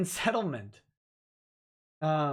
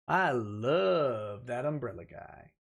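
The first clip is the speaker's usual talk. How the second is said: Tug's accent; 6 semitones lower, much slower than usual. Shown as a demonstration. Host doing Tug's accent for a viewer, slow and low. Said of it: "Say in Tug's accent".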